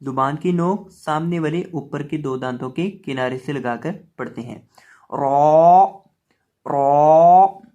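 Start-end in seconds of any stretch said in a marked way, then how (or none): 5.1-7.6 loud रौ with a thick, rounded long vowel; drawn-out, emphatic रौ demo; heavy, full letter. Shown as a demonstration. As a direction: make रौ full-mouthed and hold it out. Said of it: "रौ को हम पुर करके पढ़ेंगे".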